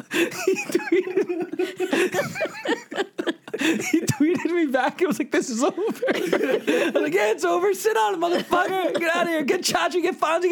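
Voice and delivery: giddy high-pitched laughing retelling; cracking up. Real, not acted. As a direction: squeal it out while you can't stop laughing.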